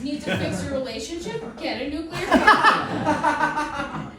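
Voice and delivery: infomercial pitch. Pretend.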